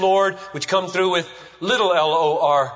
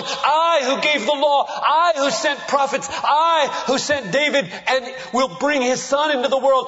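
proclaiming as God; 7 semitones higher than usual. Acted. Preacher voicing God to the congregation, exalted ringing proclamation. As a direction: thunder out God's self-declaration, each I hammered.

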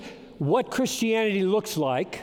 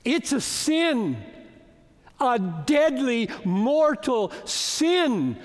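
slow grave warning; 4 semitones higher, slower than usual. Real, not acted. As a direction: weigh down every word as a warning. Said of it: "Now listen".

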